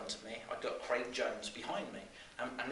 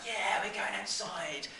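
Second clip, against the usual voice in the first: put-on high, drawn-out childlike cheer; excited kids; 8 semitones higher, slower than usual. Class hears they are going outside, excited kids' cheer. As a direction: cheer like a thrilled schoolchild, high and slow.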